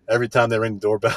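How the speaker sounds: ordinary delivery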